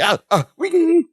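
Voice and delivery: high, slow "Ah, ah, wing!"; mock yelp. Mimicking Larry's startled "Ah, ah, wing!" at the podium, high and slow. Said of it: "He does the".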